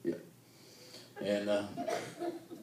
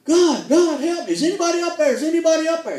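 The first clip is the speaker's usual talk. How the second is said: high, louder, calling up for help in hammed-up panic; mock desperate calling; 7 dB louder than usual. A man hanging over the drop calls for help, in hammed-up desperation. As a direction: shout upward as if stranded on a cliff.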